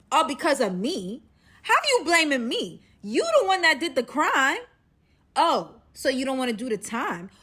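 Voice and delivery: loud, high-pitched offended retort; worked-up arguing, punching the words; indignant comeback. Retold or imitated. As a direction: throw the blame right back, incredulous. Retold quarrel, blamed passenger argues back.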